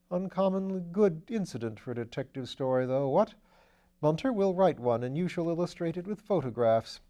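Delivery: lightly voiced upper-class gent; airy, amused aristocrat; breezy gentleman voice. A narrator's reading. Wimsey's voice in the reading.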